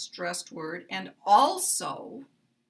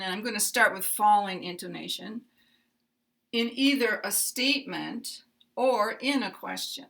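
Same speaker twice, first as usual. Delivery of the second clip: usual speaking voice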